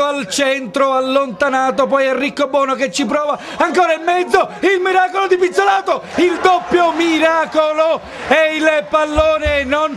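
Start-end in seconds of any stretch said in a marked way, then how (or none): 3.6-10.0 excited commentary. Real, not acted. Double save, live commentary.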